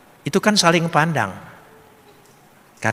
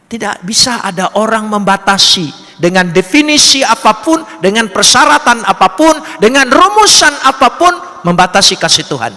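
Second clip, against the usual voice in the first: louder, higher with each phrase; preaching with rising force; 8 semitones higher, 5 dB louder than usual. Real, not acted. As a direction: build the list to an emphatic peak. Preaching builds to full force. (khotbah berapi-api, makin lantang dan tinggi)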